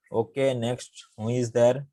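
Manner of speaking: ordinary delivery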